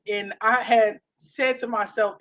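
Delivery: ordinary talk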